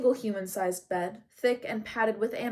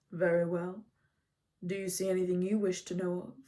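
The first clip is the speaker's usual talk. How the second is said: a lightly lower, even centaur teacher; lightly voiced wise centaur; Chiron's voice; 3 semitones lower than usual. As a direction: speak as the calm old centaur. Chiron answers Achilles.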